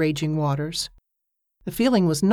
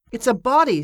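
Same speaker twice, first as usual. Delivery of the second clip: higher, triumphant announcement; 5 semitones higher, 3 dB louder than usual. Acted. Mate telling Mick the floating thing is a body, triumphant. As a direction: crow it like you found treasure. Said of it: "said the mate triumphantly".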